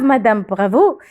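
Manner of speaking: usual speaking voice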